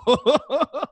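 big loud laugh bursting out; belly laugh. Real, not acted. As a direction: laugh hard, high and loud. Hearty laugh at the video.

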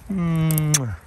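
hummed "mwah" kiss; loud kiss sound. Real, not acted. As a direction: plant a big audible kiss. Kissing the tree.

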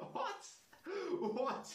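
high, loud rising what; incredulous laughing cry; what, cracking up; laughing disbelief. Real, not acted.

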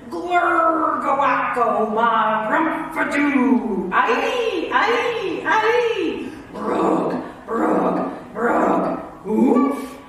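loud, goofy nonsense noises; wild comic gibberish performance; silly sounds. Pretend. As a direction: ham up every gibberish sound. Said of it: "make silly sounds like".

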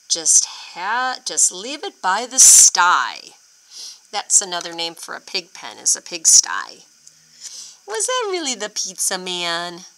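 plain reading voice

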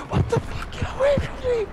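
panicked shriek. Real, not acted. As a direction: shriek in disgust and fright. Bug crawling into his room.

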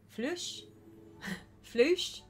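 questioning "flush", said floosh, rising high; trying out "flush". Real, not acted. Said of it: "it's pronounced".

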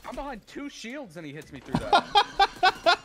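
loud, slow, disbelieving yell; outraged shout. Real, not acted. Outraged at being shot through shields.

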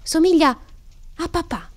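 put-on high-pitched, triumphant child's answer; eager little girl revealing the answer; excited announcement. A narrator's reading. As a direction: announce it loudly, then drop the volume. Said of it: "esclamò Carol".

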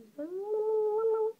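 long, high rising "ooh" noise; playful whoop. Pretend. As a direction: let out a loud, high sustained whoop. Playful noise while colouring in.

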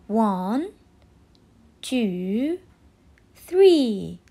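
slow count, loud on three; teaching a child to count. Shown as a demonstration. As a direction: count out slowly and hit three. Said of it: "can you count with me these candies".

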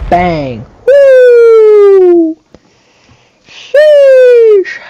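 screaming woo and sheesh, very high and loud; ecstatic yell. Real, not acted. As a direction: explode with joy at the homer. Home run celebration.

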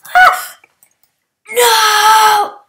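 sudden shrieking panic, high and loud; real startled yell; panicked scream. Real, not acted. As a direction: scream it as the attack lands. Sparkler thrown, panicked.

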